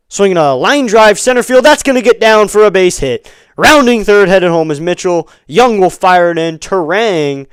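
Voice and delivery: loud, high run-scoring call, easing toward the end; excited play-by-play. Real, not acted. Announcer calls an RBI single as the run scores, excited. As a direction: call the hit as it unfolds, full voice.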